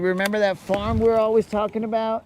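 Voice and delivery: coaxing high sing-song. Pretend. Coaxing the hamster before the end.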